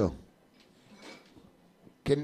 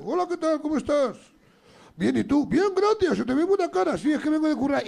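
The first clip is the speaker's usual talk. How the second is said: voz aguda, un poco teatral; diálogo fingido; 8 semitones higher than usual. Retold or imitated. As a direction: hacerse las dos voces del diálogo. Contesta por el estanquero. (imitando a la vez saludo y respuesta, agudo)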